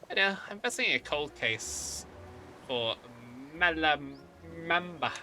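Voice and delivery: hard-boiled detective voice; noir detective. Acted. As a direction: play a noir movie detective. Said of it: "you sound like a detective in a noir movie".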